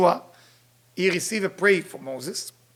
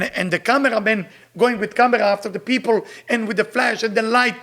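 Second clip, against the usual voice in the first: ordinary talk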